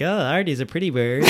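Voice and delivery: high, chirpy bird voice; parrot voice. Retold or imitated. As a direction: squawk it like a parrot. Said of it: "singing bird that's just like".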